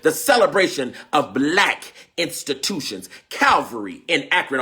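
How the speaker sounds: ordinary talk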